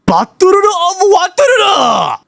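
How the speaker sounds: very high, drawn-out mock Japanese accent. Shown as a demonstration. User demonstrates for the AI, shouted Japanese accent.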